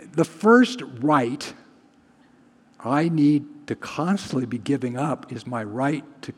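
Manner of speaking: ordinary talk, nothing marked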